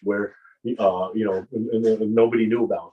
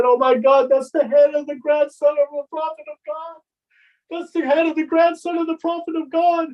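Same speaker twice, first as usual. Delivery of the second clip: higher, louder horrified cry; anguished scream; 4 dB louder than usual. Retold or imitated. Man recognizes the head of Hussein and cries out in horror. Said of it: "he started to scream".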